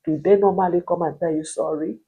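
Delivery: ordinary talk, nothing marked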